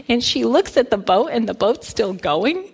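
ordinary delivery

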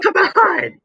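very high, strained cry; mock scream. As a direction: join in with a piercing play-acted scream. Joins in acting the screaming brothers.

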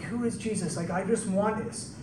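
soft, hushed wondering; quietly yearning to see him; longing hush. Retold or imitated. Tax collector in the tree, softly yearning to see Jesus.

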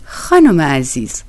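slow, coaxing hushed voice; affectionate. Acted. Koroviev coaxes the doorkeeper woman with a slow, hushed affection. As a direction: sweet-talk her gently. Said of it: "کروویف با محبت شروع به صحبت کرد".

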